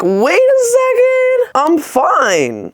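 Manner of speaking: high, stretched-out falsetto exclamation, easing down; hammed-up relief in falsetto; mock realization. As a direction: act out a drawn-out, squeaky-high sudden revelation. Acted realization that she is fine.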